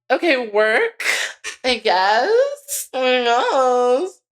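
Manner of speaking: high, drawn-out uncertain reply; acted hesitant compliance. Student giving in to the rules, high and hesitant. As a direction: go along with it in a small high voice. Acts out reluctant going along.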